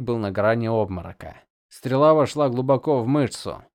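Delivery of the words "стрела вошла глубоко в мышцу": ordinary delivery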